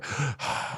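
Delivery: soft unvoiced sigh of relief; quiet sigh before moment; relieved sigh. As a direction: breathe out the day's weight. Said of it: "He goes".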